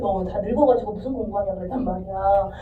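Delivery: ordinary talk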